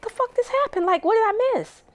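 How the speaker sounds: high, stunned retelling of confusion; a startled what-happened; shocked disbelief. Retold or imitated. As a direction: voice jumps way up in shock. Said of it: "I'm like".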